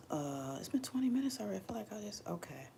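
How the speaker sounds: whispered aside to herself; real quiet whisper, muttered aside. Real, not acted. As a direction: murmur it under your breath. Aside to self mid-stream.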